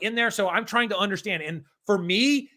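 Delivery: ordinary talk, nothing marked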